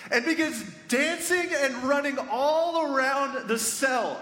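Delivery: high-pitched, excited storytelling; breakneck-joy narration, pitch way up; exhilarated. Retold or imitated. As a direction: tell it swept up in the joy. Narrating the guard's wild joy.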